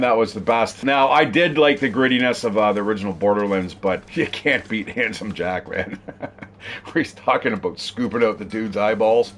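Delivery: ordinary delivery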